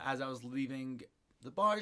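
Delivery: ordinary talk, nothing marked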